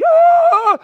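long, very high loud held wail; imitated high-pitched yell of struggle; loud sustained squeal of effort; mock straining cry. Acting out futile straining swim.

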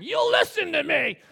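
slow, high, loud royal shout; shouted, slow tyrant's command; Rehoboam's angry decree. Retold or imitated. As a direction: roar it like a haughty king. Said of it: "kinda Rehoboam going".

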